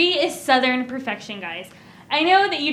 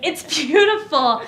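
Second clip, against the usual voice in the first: slow gushing compliment; 3 semitones higher, 4 dB louder, slower than usual. Retold or imitated. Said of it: "I was like".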